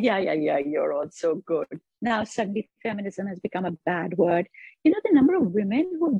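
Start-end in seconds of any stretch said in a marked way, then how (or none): 0.0-1.7 dismissive mock praise. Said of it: "they're all like".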